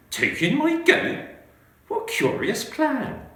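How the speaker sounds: put-on a flustered little old lady pleading for help; fretful old lady. Old lady doubting the advice.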